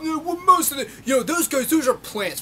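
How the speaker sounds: high, loud, flustered mock voice; mocking impersonation. Vlogger mimics an opponent defending the group, high mocking voice.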